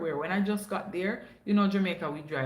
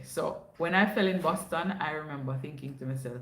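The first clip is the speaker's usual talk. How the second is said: ordinary delivery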